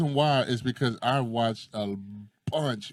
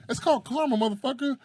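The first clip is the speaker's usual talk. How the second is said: high-pitched gleeful taunt; playful telling-off; mock scolding; 11 semitones higher than usual. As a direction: throw it at him, pitched way up. Mock lecturing the cop.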